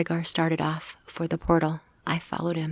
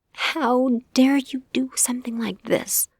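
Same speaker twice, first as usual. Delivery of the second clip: furious hissed whisper, higher and slow; 7 semitones higher, 3 dB louder than usual. Acted. Girl hisses at Gregor for sneaking off, furious whisper. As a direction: hiss it at him through the dark. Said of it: "I hissed".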